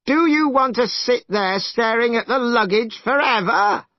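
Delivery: angrier shout. Acted. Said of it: "angrier than ever".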